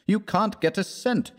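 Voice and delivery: high, exasperated insistence. Acted. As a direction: insist in frustration. Trent scolds his poor friend.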